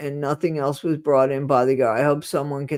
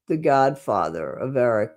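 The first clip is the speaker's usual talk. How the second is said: ordinary delivery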